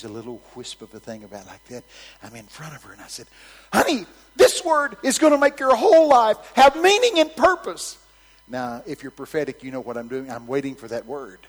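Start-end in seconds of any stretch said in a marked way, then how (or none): 3.7-8.0 high, full-volume proclamation; shouted prophecy. Retold or imitated. Preacher standing before the granny, shouted prophecy. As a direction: boom out the big promise.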